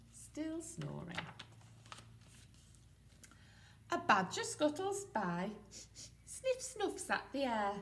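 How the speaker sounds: lively picture-book read-aloud; reading to children. A narrator's reading. Teacher reads aloud to nursery, storybook voice.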